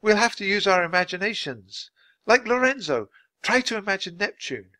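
high-pitched urgent urging; shout. A narrator's reading. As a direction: coax someone urgently over the wind. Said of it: "shouted Annie".

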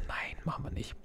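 breathy whispered refusal. Real, not acted. Whispering to himself while sorting items, whisper. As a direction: hush it, just air, no voice.

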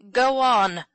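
loud, strained urging; hoarse. A narrator's reading. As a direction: urge the horse on hoarsely. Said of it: "said Ben hoarsely".

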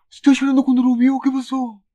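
high, loud, alarmed question; shocked that he is crying; startled outcry. Real, not acted. Viewer seeing the character cry, high and alarmed.

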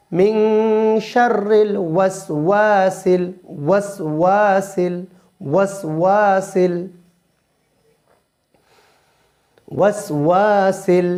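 slow, spaced recitation for repeating; slow drill. Shown as a demonstration. Teacher drilling ওয়াসওয়াসিল for students to repeat. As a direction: say each word slowly for echoing.

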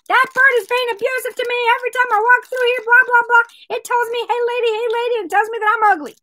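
high, rapid mock tirade; yipping complaint. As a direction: rattle off the grievance as the offended lady. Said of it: "She was yipping at the owner".

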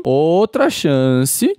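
"Outra chance" stretched very slowly, rising at the end; "Outra chance" dragged slowly with a hopeful rise; pleading drawn out. Shown as a demonstration. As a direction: draw out "Outra chance" slowly, begging the Pokémon. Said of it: "ó, Mamoswine, outra chance".

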